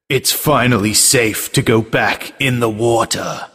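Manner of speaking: slow, dramatic trailer read; movie trailer voice. Acted. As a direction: announce it like a film trailer. Reads tagline as trailer.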